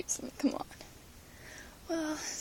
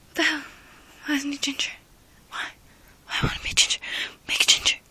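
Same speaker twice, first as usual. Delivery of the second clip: louder shouted whisper; pretend outrage; 8 dB louder, faster than usual. Fan imagining outrage at the next doctor, loud. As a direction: demand it like a frantic fan. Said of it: "I'll be like".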